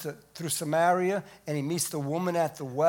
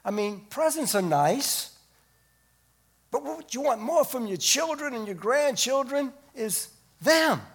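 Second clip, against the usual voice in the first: ordinary delivery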